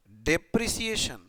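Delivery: dictates word by word with long pauses; slow dictation. Shown as a demonstration. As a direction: let students write each word. Dictating working note. (धीरे-धीरे लिखवाते हुए)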